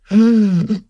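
sharp high gasp of delight. Real, not acted. Baby brought in.

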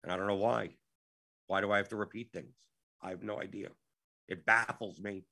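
ordinary delivery

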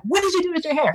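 high-pitched hairstylist's exclamation; mock shocked. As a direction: exclaim it high, in playful disbelief. Playful imagined reaction of the hairstylist.

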